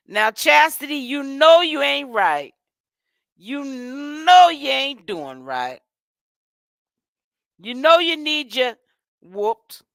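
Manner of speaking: scolding. Real, not acted. Host scolds Chastity for stealing from her sister, slow and loud. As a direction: wag a finger in your voice.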